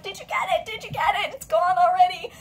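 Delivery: high, eager repeated asking; excited friends asking; anxious urging. Retold or imitated. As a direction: voice the group fretting. Group anxiously asking online.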